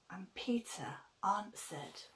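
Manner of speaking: soft unvoiced whisper; whispered narration. A narrator's reading. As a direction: hush it.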